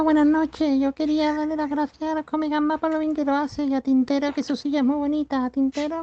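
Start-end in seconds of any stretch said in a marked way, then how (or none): none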